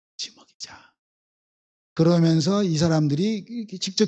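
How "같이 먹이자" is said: soft unvoiced whisper of the invitation. Preacher whispering Jesus' invitation to the disciples, hushed. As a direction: breathe it like a secret.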